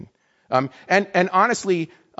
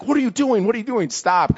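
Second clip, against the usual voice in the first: alarmed protest; 8 semitones higher, 3 dB louder than usual. Retold or imitated. Imitating a colleague grabbing her to stop.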